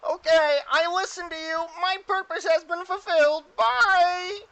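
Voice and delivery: very high, very loud squeal; Mister Meeseeks. Acted. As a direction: belt out a squeaky, final farewell. Meeseeks answers and leaves.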